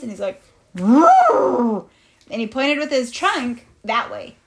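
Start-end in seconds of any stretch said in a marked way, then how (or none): 0.8-1.9 loud imitated elephant trumpet. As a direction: trumpet out a long woo. Elephant points with trunk.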